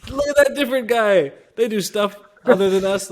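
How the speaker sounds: high, loud comic quote with laughter; mock curious onlookers. Russians remarking on a different guy, mock amazed. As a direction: point someone out, amused and high. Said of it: "They're like".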